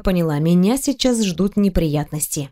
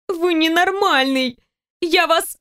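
furious; 7 semitones higher, slower than usual. A narrator's reading. Heroine furious at boss.

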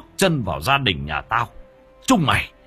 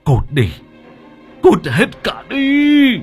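loud yell rising into a drawn-out high scream; furious shout; 8 dB louder, much slower than usual. A narrator's reading. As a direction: bellow them out, stretching the last word. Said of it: "Chú tức giận". (tức giận quát đuổi)

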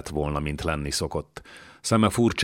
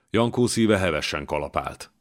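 plain reading voice

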